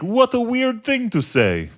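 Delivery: slow, high, hammed-up Russian accent; mock Russian bar customer, deadpan and blunt. Russian bar customer answering the comedian, in a Russian accent. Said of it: "the Russian guy was like".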